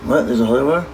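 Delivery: usual speaking voice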